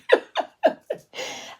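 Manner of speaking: high voiceless burst of laughter; breathy laugh. Real, not acted. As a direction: laugh out at the cheeky line. Speaker laughs at the story.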